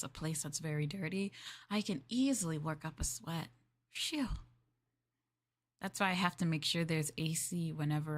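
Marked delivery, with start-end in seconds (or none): none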